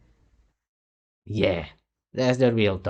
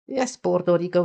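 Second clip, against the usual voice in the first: higher, sarcastic yes; sarcastic emphasis, pitched up; mocking; 8 semitones higher than usual. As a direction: lean on yes with a scoff. Continues the mocking echo.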